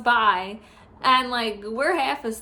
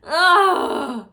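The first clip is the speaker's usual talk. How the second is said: louder, higher cry of disgust; loud disgusted groan; 5 semitones higher, 7 dB louder than usual. Real, not acted. Remembering the awful hospital, a loud disgusted ugh.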